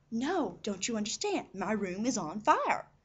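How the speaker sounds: loud, slow, word-by-word protest; emphatic insistence. Retold or imitated. Mom to the disbelieving nurse, emphatic and exasperated. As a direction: insist urgently to someone who won't believe it.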